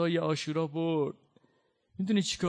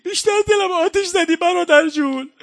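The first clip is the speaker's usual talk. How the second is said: loud, rising wail of a sister; keen it out in grief; grieving cry; 6 semitones higher, 8 dB louder than usual. Acted. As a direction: lament rising to a high sob. Zeinab's cry voiced in rowzeh.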